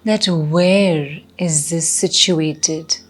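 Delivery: slow meditation guide with long pauses; guided meditation. Acted. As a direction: ease them inward, slowly and unhurriedly.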